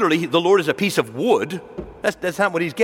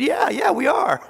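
gleeful agreement; 10 semitones higher than usual. Retold or imitated. Preacher tells a sidewalk heckler he's crazy, gleeful. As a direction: laugh it off, happily owning it.

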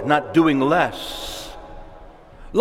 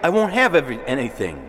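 fearful pleading; 6 semitones higher, faster than usual. Acted. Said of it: "That's the flesh talking".

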